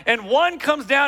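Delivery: normal talking voice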